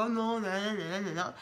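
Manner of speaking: ordinary talk, nothing marked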